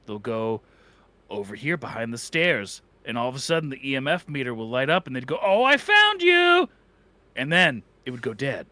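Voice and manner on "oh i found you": high, slow, delighted hide-and-seek call; playful found you. Pretend. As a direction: sing it out like a game with a child. Ghost hunter playing hide and seek.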